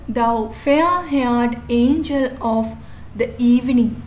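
slow, beat out "fair", "an-" and "eve-" in "Thou fair-haired angel of the evening"; alternating stress pattern. Shown as a demonstration. Teacher demonstrates the meter of a poem line, alternating stress. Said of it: "this is the pattern".

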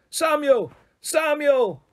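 very high-pitched hail; calling through megaphone. A narrator's reading. Wife hails her husband from a helicopter, calling out. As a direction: call the name from high above. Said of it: "she now had a real megaphone".

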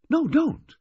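put-on sudden high, loud protest in a girl's voice; vehement. A narrator's reading. Girl stopping the waiter from leaving, vehement. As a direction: blurt it out high and forceful. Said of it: "She was unexpectedly vehement".

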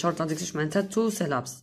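usual speaking voice

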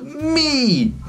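very high, excited "Me!"; joyful yell. Acted. Charlie leaping into the mud, joyous high cry. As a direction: shout it mid-leap.

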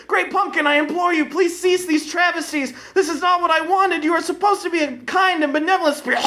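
put-on a child pleading with his idol to stop; desperate boy pleading. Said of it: "called to the fiery demon".

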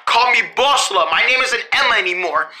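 usual speaking voice

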